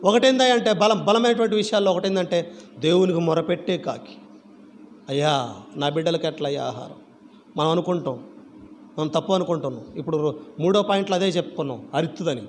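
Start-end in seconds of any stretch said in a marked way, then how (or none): 5.1-6.9 low, soft plea to God; acted prayer. Parent asking God for the children's food, low humble plea. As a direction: quietly voice a worried parent's prayer. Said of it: "ప్రార్థన".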